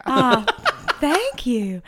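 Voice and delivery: slow cartoonish Southern drawl; fake Southern accent. Podcaster accepting accent praise, still in fake Southern drawl. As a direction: stay in a drawn-out mock Southern accent. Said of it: "That accent is so authentic".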